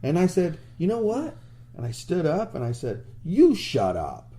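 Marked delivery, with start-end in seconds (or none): none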